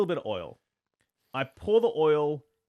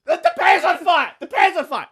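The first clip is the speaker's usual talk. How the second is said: put-on high, loud panicked shouting to the girlfriend; retold screaming; 13 semitones higher, 12 dB louder than usual. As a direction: call upstairs in alarm. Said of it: "So I'm, like, screaming".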